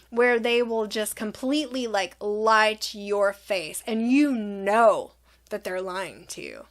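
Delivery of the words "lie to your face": ordinary talk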